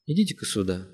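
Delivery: low, soft beckon; мягкий зов; gently calling. A narrator's reading. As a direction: call him over gently. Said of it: "мягко позвал Симагин".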